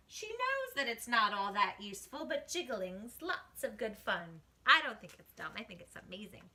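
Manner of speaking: plain reading voice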